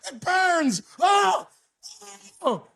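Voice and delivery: loud, high screaming pain; mock burning agony; agonized shout. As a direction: howl like it's burning you. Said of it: "guy was freaking out".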